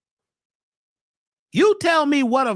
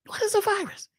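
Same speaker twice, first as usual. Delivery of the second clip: high-pitched, softer mocking question; 10 semitones higher, 5 dB quieter than usual. Rhetorical mock question.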